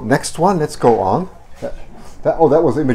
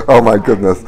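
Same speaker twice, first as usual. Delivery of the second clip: normal talking voice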